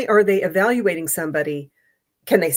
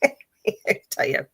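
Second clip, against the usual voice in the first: laughing; 9 semitones higher, much slower than usual. Real, not acted. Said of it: "laughing aside".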